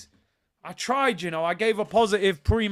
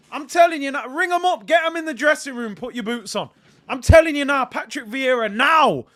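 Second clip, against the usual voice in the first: excited urging; 7 semitones higher, 9 dB louder than usual. Real, not acted. Fan urging legends back on.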